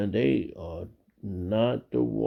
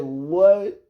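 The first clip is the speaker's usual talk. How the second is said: startled what; 8 semitones higher, 6 dB louder than usual. Retold or imitated. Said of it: "I said".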